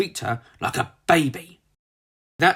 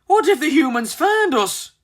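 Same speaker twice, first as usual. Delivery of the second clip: worried; 12 semitones higher, 4 dB louder than usual. Acted. Frightened birds fear discovery.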